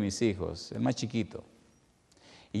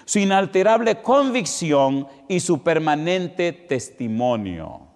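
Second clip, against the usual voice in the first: louder, slow, emphatic sermon delivery; full-force preaching; 6 semitones higher, 7 dB louder, slower than usual. Acted. Preacher proclaiming to the congregation, slow and loud. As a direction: proclaim each phrase slowly to the congregation.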